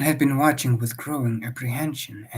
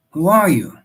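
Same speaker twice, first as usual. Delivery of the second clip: loud, higher, sharp demand; 5 semitones higher, 11 dB louder than usual. Acted. Husband demanding the horsemen name themselves, loud. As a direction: insist on an answer. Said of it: "he demanded".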